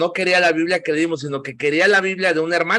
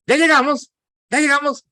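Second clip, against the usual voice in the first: high, louder imitation of a nagging child; pestering little daughter; 11 semitones higher, 4 dB louder than usual. Daughter half an hour into trip, imitated high nagging. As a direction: pipe up high like an impatient kid. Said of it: "me pasa con mi hija".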